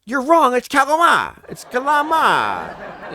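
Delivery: loud, high mocking mom voice; hammed-up mom insisting calamari; mock mom correcting. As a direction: parrot her smug correction. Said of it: "And you're like".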